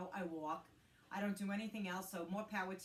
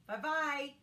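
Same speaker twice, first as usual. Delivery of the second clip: cheery goodbye; 8 semitones higher, 9 dB louder than usual. Real, not acted. Signing off cheerily.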